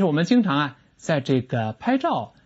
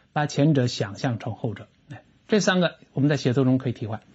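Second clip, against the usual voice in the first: usual speaking voice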